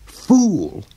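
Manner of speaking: put-on angry child's cry. Boy crying out in anger at his father. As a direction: spit the word out at him.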